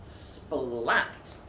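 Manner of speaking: ordinary delivery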